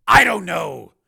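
high, loud bewilderment; dazed but forceful; somewhat dazed. Acted. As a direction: answer loudly, at a loss. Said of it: "somewhat dazed".

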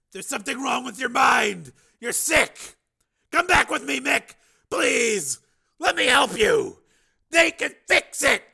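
high, loud, desperate pleading; shouted plea. Acted. Man begs sick friend to come back, shouted plea. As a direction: call out urgently to get through to him.

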